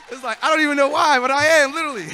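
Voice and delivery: high, fast, laughing through nerves; nervous laughing talk. Real, not acted. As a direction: giggle the words out. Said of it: "I am so nervous".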